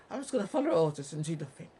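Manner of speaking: loud, fast mocking jobsworth voice; mock officer. Vlogger mocks a police officer's excuse, loud and fast. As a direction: bark it like a dumb order-follower.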